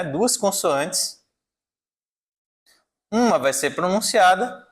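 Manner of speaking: ordinary delivery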